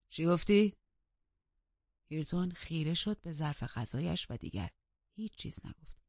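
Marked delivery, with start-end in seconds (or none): 0.1-0.7 loud, changed voice snapping the question; sharp demand. Acted. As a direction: bark it back at him. Master snaps at Hareton.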